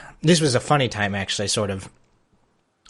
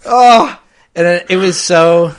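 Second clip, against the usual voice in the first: higher and louder, slow, breaking into laughter; laughing while telling; 11 semitones higher, 9 dB louder, slower than usual. Real, not acted. Recalling crying alone in the theater, laughing through it higher and louder.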